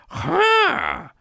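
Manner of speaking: high sharp huff of disbelief; a high incredulous huff; exasperated huff. Retold or imitated. Boss staring at unfinished work, high huff. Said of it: "you go".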